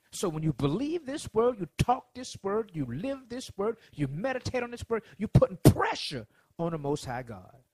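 loud, rising preacher build; preaching. Acted. Preacher driving home believing God's word, loud and rising. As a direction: preach it hard to the congregation.

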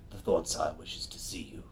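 hushed, breathless whisper. Acted. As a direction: whisper the invitation. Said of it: "they're like".

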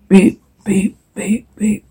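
soft, odd robot beeping; robot beeps. Acted. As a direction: beep like a small machine. Robot beeping, timbre shifted.

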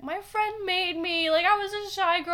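high, protesting retold plea; pleading excuse. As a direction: protest your innocence like a kid.

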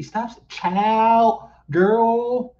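put-on high, loud drawn-out old church lady; mock church lady. Commentator mocking a woman's way of talking, high church-lady imitation. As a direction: mimic her like a scolding old churchwoman. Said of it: "Why do you sound like an eighty-eight-year-old church".

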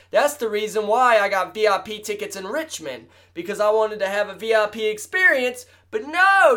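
mock-furious rant in a high voice; high indignant rant. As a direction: scold Ticketmaster as if they lied to you. Angry rant at Ticketmaster.

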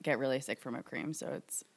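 soft, breathy aside fading out; a real aside that sinks to a whisper. Real, not acted. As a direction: say it under your breath. Aside about whipped cream.

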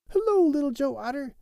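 a happy mink welcoming a friend; Billy Mink voice. A narrator's reading. Billy Mink greets otter.

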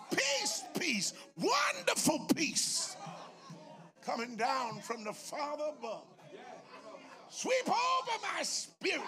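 soaring, stretched preacher's recitation; impassioned hymn recitation. Real, not acted. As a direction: lift the opening words high, then settle. Recites hymn lines in sermon.

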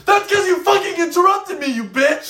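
high, loud furious yell; freaking out. Retold or imitated. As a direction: blow up at her mid-joke, screaming. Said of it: "him freaking out on her".

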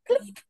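clicking sound. Retold or imitated. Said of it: "imitating a door lock sound".

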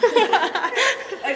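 laughing. Real, not acted. Laughing while answering.

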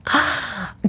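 sharp high gasp; panicked gasp. Retold or imitated. Mother panicking at the appointment call, sharp gasp. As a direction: suck in air in panic.

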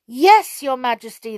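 loud, eager chorus reply; eager cry. A narrator's reading. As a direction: call out eagerly. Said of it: "they cried".

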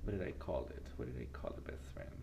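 whispered, fast, to herself; whispered aside. Real, not acted. Host trying to recall a segment name, whispered. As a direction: mutter it under your breath to yourself.

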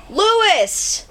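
high called-out name; yell. Retold or imitated. Trucker yelling Lewis's name from behind, high call. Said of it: "he just yells".

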